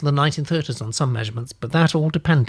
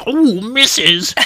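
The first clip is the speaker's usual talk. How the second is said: slow, drawn-out camp exclamation, higher and louder; Frankie Howard; 8 semitones higher, 6 dB louder, much slower than usual. Shown as a demonstration. Host sending up his own reading, hammed-up music-hall camp. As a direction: ham up a saucy music-hall cry. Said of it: "it was very Frankie Howard".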